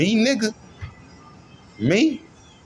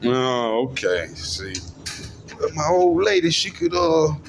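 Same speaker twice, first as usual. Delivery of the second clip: ordinary delivery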